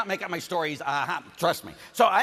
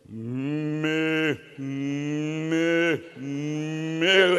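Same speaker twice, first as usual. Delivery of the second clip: mooing calf; 3 dB louder, much slower than usual. Retold or imitated.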